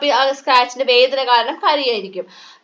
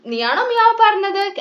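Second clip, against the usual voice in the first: put-on much higher, childlike puppy voice; high-pitched puppy question; puppy asking; 7 semitones higher than usual. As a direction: ask it like a small eager puppy. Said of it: "നമ്മുടെ puppy ചോദിക്കുകയാണ്".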